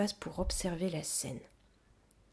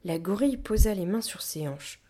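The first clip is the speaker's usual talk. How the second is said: ordinary delivery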